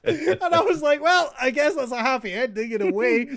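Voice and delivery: high, loud, amused retelling; retelling through laughter. Retold or imitated. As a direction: recount it loudly, cracking up at your own story. Cracking up at his own anecdote.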